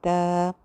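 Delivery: त said long and soft behind the two front teeth; one slow gentle त. Shown as a demonstration. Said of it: "दो दांतों के पीछे लगाकर आपने बोलना है".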